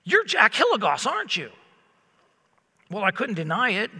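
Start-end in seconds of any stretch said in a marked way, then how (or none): 0.0-1.5 stranger recognizing him. Retold or imitated. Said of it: "and he goes".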